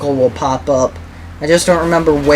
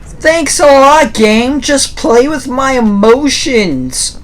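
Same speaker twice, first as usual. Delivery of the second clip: sarcastic; 7 semitones higher, slower than usual. Real, not acted. Relieved sarcasm at game.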